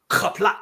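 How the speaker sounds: Kapla with guttural opening and abruptly stopped final vowel; fast cutoff. Shown as a demonstration. Teacher demonstrating the ending, guttural Kapla with fast cutoff. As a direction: cut Kapla off sharply after a throaty start. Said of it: "the fast cutoff".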